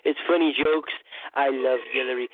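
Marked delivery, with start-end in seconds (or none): none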